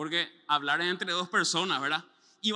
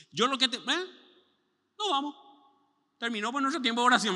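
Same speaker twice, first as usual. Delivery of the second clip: higher, louder, quick; hurried believer; 5 semitones higher, 4 dB louder than usual. Retold or imitated. Believer cutting off God to end prayer, hurried and higher. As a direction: rush off impatiently.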